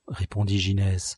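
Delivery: ordinary delivery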